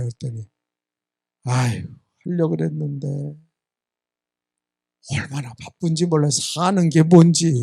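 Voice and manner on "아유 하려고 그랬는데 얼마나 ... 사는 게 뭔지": mock-weary, lower and softer excuse. Congregant excusing missed dawn prayer to the pastor, mock weary. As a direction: imitate a worn-out congregant making excuses, drawing out the end.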